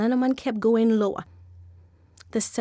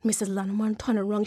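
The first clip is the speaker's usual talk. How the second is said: usual speaking voice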